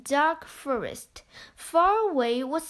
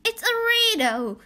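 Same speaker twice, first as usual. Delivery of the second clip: put-on higher, bright child; a clever child, pitched up; Home's voice; 8 semitones higher, 4 dB louder than usual. A narrator's reading. Home explains the riddle, higher child voice. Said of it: "said Home".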